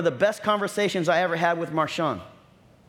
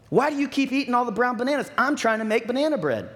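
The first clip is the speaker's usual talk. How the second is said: higher-pitched mock exasperated wife; upset and irritated; 7 semitones higher than usual. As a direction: act out a fed-up wife's complaint. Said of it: "she gets so upset and irritated".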